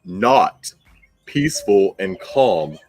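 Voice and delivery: normal talking voice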